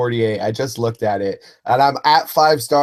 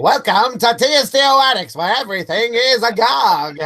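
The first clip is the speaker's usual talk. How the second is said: put-on higher old-time announcer voice; vintage newsreel host, higher pitched; 1930s news announcer; 6 semitones higher than usual. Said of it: "We should do an entire Attics just with that".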